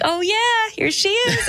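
very high sing-song fake politeness; mock polite high chirp; fake sweet. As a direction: chirp it sweetly while dodging. Fake-sweet reply, lifting baby.

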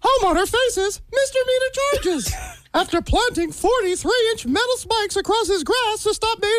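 cartoon mouse reading the headlines; Mickey Mouse. Guest reads a silly local headline to hosts, as a cartoon mouse. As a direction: put on a cartoon mouse newsreader voice. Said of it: "That sounds like Mickey Mouse".

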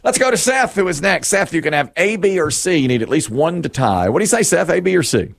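high, bright-paced host patter; cheery game show host voice greeting the contestant. Acted.

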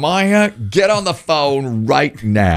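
high, slow, drawn-out order; bossy roommate. Retold or imitated. Host imitates a woman ordering her inactive roommate, mock urgent. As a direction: mimic an exasperated order, stretching each word. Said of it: "She's like".

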